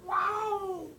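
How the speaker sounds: squeaky high "wow"; high cat call. Real, not acted. Calling the cat.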